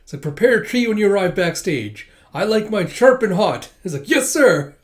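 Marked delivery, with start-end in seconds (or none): 0.2-3.7 loud, raised order; drill command. Retold or imitated. Senior orders junior to greet, loud command. 4.1-4.8 loud, high acknowledgement; eager obedience. Retold or imitated. Junior answers senior's drill, eager loud reply.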